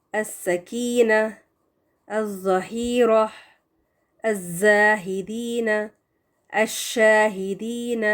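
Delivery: each sun-letter word drawn out slowly, lam assimilated; careful Arabic recitation with merged lam; slow tajweed recitation. Shown as a demonstration. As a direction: recite like a Quran teacher, word by word. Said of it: "لام کو پڑھا نہیں جائے گا".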